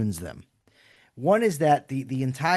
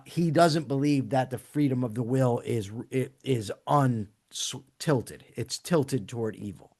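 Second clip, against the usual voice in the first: usual speaking voice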